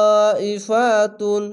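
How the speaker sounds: তাইফাতুন slow, vowel held four beats; long madd lazim. Shown as a demonstration. Teacher showing students the four-beat stretch, slow drawn-out recitation. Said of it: "চার আলিফ টেনে পড়বেন".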